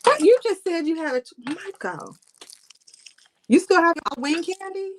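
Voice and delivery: high, disbelieving question; shocked disbelief. Real, not acted. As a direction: ask it in total shock. Shocked by old candy.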